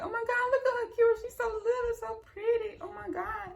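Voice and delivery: very high, teary gushing mom; imitating mom's emotional squeal; mom crying with joy. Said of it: "my mom started crying".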